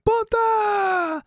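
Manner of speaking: very high, loud drawn-out cry; gleeful crowd yell. Acted. Captors seizing on the first guy's choice, high crowd yell.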